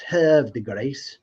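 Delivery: "Havre de Grace" slow, with "Havre" as /hɛv/; retry "Havre de Grace" slowly, first syllable "hev"; second try at the name. Shown as a demonstration. Host retrying a viewer's town name, slowly. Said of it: "get me to pronounce where he lives".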